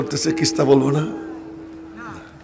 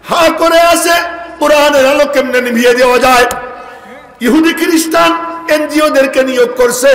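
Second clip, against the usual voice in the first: higher and louder, building warning; fiery preaching; 5 semitones higher, 3 dB louder than usual. Real, not acted. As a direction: rouse the crowd against enemies. Fiery preaching about enemies. (উত্তেজিত জোরালো ওয়াজ)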